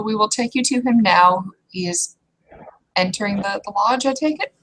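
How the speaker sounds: ordinary delivery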